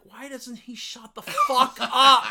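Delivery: loud, high, drawn-out mock fury; acted exasperation. Diner complaining to companion about a loud guy, exasperated. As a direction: explode in exaggerated annoyance.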